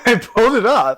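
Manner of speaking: loud, high, gleeful reveal; triumphant unmasking. Acted. Player unmasking the Goblin Man, loud and gleeful. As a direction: rip the disguise off with glee.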